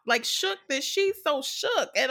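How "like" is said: usual speaking voice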